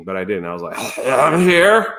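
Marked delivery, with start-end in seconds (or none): 1.1-1.9 imitating a just-woken croak, higher and louder; mock froggy wake-up reply; uncleared sleepy voice. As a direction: blurt it out without clearing your throat. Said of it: "should have cleared my voice".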